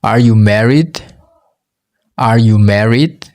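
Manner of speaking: usual speaking voice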